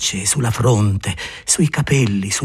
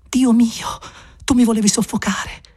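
breathless Maria; 9 semitones higher than usual. Acted.

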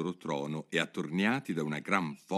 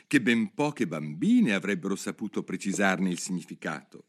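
usual speaking voice

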